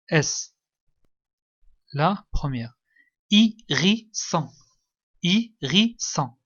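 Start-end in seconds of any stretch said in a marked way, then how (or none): 3.3-4.5 slow, split syllables. Shown as a demonstration. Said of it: "La première syllabe ou bien la deuxième". 5.2-6.4 syllable by syllable. Shown as a demonstration. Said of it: "La première syllabe ou bien la deuxième".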